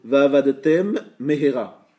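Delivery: "Va'avadetem mehera" slow and loud, M closed then restarted; both mems distinct. Shown as a demonstration. Teacher showing two mems in a row, slow and distinct. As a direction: hold the end of "Va'avadetem", then begin "mehera" afresh. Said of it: "Il y a deux mèmes qui se suivent".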